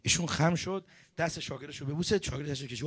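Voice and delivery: ordinary talk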